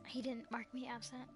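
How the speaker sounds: usual speaking voice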